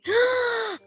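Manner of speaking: sharp startled gasp. Real, not acted. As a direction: catch your breath in alarm. Susto com o Nick na escada. (suspiro assustado)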